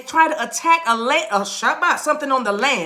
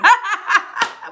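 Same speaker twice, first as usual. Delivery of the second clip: high burst of laughter; hearty laugh; 8 semitones higher than usual. Real, not acted. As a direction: crack up at your own joke. Laughs at own joke.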